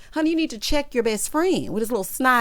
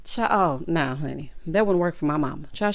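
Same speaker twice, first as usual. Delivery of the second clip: ordinary talk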